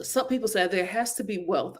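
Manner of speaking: ordinary delivery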